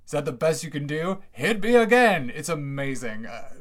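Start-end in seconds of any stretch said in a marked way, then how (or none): 0.1-2.3 mock taunt that builds to a shout, much higher and louder; defiant taunt. The guy taunting Zeus after the thunderbolt, building to a defiant shout. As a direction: dare the god to strike again, ending in a shout. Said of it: "And the guy goes".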